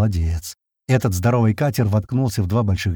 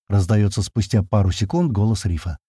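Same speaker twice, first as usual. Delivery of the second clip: ordinary delivery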